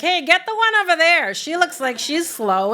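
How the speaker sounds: high, loud teasing voice of the gorillas; mocking gorillas. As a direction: play the gorillas jeering at the humans below. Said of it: "it was like they were mocking us".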